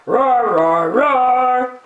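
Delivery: loud, high, hammed-up roar; bear roar. Bear sings into the microphone, loud playful roar. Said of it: "went the noisy bear".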